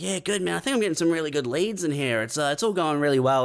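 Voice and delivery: high, fast, breezy upbeat prisoner; cheerful inmate faking success on his phone call. Pretend. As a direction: brush it off like everything's great. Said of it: "He's like".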